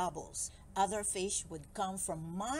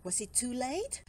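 ordinary delivery

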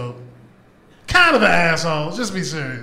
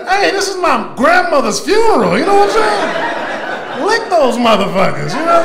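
mock scolding; 9 semitones higher, 6 dB louder than usual. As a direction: scold the chapstick user, voice way up.

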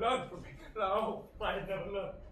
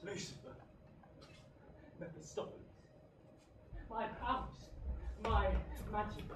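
hushed confession; 8 dB quieter than usual. Acted. Faustus confesses names quietly.

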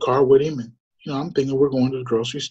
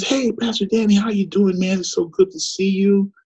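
put-on high, bright-pitched hello to an old friend; excited greeting; 8 semitones higher than usual. Shop owner greeting the pastor, high and excited. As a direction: greet him like a long-lost buddy. Greeting retold again, same voice.

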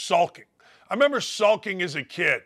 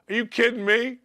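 ordinary talk, nothing marked

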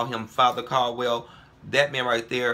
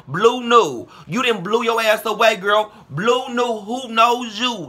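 louder, higher mocking call-out; raised, scornful diss aimed at one person; heated call-out; 6 semitones higher, 4 dB louder than usual. Real, not acted. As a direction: roast her by name, voice raised high. Calling out a rival YouTuber.

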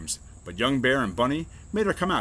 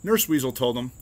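usual speaking voice

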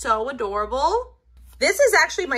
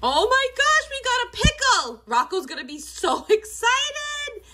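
excited squeal; 12 semitones higher than usual. Real, not acted. Pulling a pickle from a blind bag, high thrilled squeal. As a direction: squeal like you just found the prize. Pulls a rare pickle toy.

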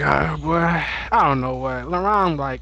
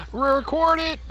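excited shout; 13 semitones higher, slower than usual. Real, not acted. Hyped gamer yelling to record the clip. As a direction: yell at them to record it.

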